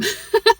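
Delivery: sudden high, loud burst of laughter; real laugh. Real, not acted. Woman joking to viewers about seeming dumb, real laugh.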